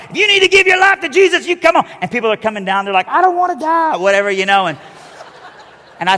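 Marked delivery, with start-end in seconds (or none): 0.1-1.9 high, loud mock preacher cry; preacher's altar call. Old preacher calling people down the aisle, hammed-up high shout. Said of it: "he's like". 3.1-4.0 mock scared. Scared people at altar call.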